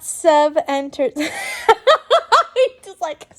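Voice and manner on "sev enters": ordinary delivery, nothing marked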